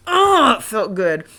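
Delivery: usual speaking voice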